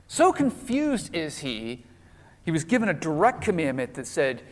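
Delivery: ordinary delivery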